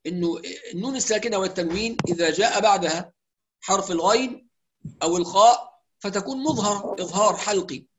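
normal talking voice

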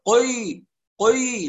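"قي" with a very dark, backed "ق" and vowel; fully heavy qaaf. Shown as a demonstration. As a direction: overdo the heaviness of "قي". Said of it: "هذا تفخيم كامل". ("قي" بتفخيم كامل للقاف)

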